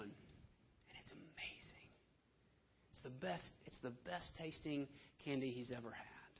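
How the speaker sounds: a soft, savouring aside; awed hush. Acted. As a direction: breathe it like a secret delight. Savouring the candy.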